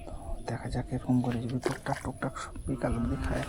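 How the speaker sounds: soft unvoiced whisper. Real, not acted. Angler whispering hopes for the evening catch to viewers. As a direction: keep it breathless and hushed by the water. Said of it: "জোরে তো কথা একদম বলাই যাবে না".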